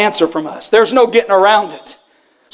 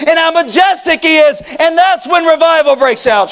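fervent preacher, raised and driving; preaching at full force; 5 semitones higher than usual. Acted. Pastor pressing the church with a fervent call. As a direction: press the question on the congregation. Preacher building toward revival.

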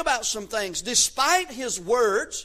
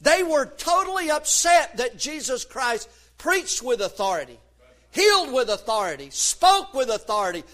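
ordinary talk

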